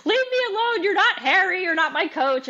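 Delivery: very high-pitched retold whine; petulant outburst. Upset fighter telling her husband to back off, mock petulant outburst.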